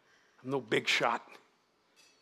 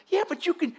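incredulous; 4 dB louder than usual. Acted. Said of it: "He couldn't get over".

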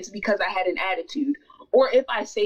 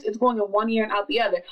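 ordinary talk

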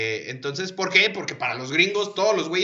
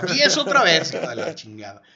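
mock angry insult; 7 dB louder than usual.

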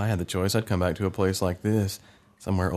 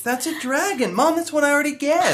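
put-on high, indignant kid voice; young boy protesting. Boy [name] complaining to Mom at dinner, high and indignant. Child self voice.

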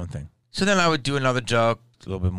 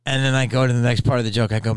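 ordinary talk

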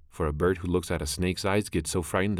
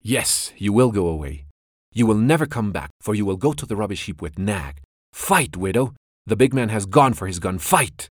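much louder, higher battle challenge; fierce taunt; 4 semitones higher, 8 dB louder than usual. A narrator's reading. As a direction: goad the widow into fighting. Mongoose taunts the snake.

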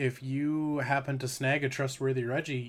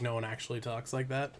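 usual speaking voice

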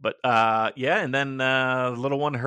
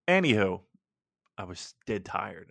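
ordinary delivery

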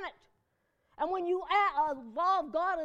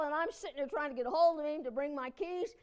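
normal talking voice

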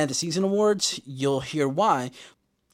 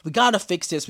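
urgent Lottie; 7 semitones higher, 4 dB louder than usual. Retold or imitated. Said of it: "Lottie's like".

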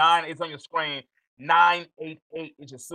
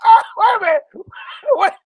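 much higher, louder, fast shout through a laugh; shocked laugh; 9 dB louder, faster than usual. Real, not acted. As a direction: blurt it out in shocked delight. Host shocked by joke.

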